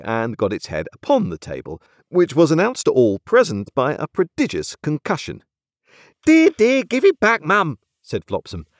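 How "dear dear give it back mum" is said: high, loud exasperated nursemaid; exasperated servant taking charge; scolding nurse. A narrator's reading. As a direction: fuss at the mistress, pitched right up. Nurse scolds Missus Pocket.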